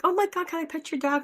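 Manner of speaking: high, eager dog-lover gush; excited retelling. Retold or imitated. Asking the security guy to pet his dog, eager and high. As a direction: squeal the question like you can't help it. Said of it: "And I'm like".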